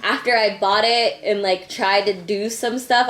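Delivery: ordinary talk, nothing marked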